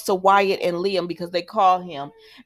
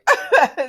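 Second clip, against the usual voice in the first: high burst of laughter; short, high, tickled laugh; amused laugh; 10 semitones higher, 3 dB louder than usual. Real, not acted. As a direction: crack up mid-rant. Laughing at repeated episode ending.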